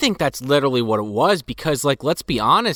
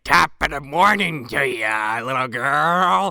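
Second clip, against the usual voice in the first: slow put-on Irish accent; drawn-out Irish brogue greeting; mock Irish accent; slower than usual. Henchman greeting a little girl, slow mock Irish accent. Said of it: "he's like".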